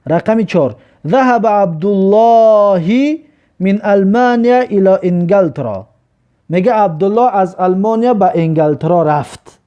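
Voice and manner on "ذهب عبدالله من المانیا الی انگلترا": very slow, raised recitation; slow model reading. Shown as a demonstration. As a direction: stretch each word for learners. Teacher reads Arabic example sentence.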